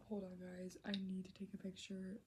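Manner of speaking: whispered, soft and hurried; hushed aside. Real, not acted. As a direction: drop to a whisper mid-thought. Urgent aside while reading.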